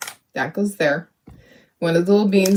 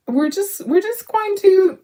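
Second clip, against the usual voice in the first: high, drawn-out hope; 11 semitones higher than usual. Real, not acted. Hopeful push.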